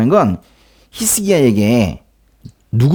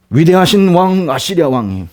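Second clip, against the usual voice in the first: grand title; 6 semitones higher, 3 dB louder than usual. Retold or imitated. Envoy hailing his own king, pompous and grand. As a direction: announce the king like a herald. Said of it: "자기 왕은 이렇게 불러요".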